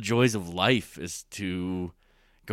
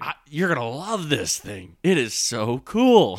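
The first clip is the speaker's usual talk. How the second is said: louder, drawn-out delighted so cool; excited friend; 5 semitones higher, 7 dB louder, slower than usual. Retold or imitated. Friend inviting someone into a passion, excited. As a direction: sell it with joy. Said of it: "You should be".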